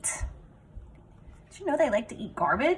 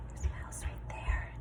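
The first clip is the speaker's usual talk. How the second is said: hushed whisper pointing out the mouse; whispered aside; 10 dB quieter than usual. Real, not acted. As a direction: whisper it like a secret.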